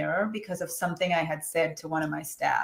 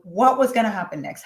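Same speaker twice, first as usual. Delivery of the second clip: normal talking voice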